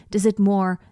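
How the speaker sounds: normal talking voice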